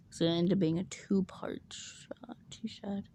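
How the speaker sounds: whispered aside. Real, not acted. Correcting herself to viewers in a whisper. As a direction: drop to a whisper.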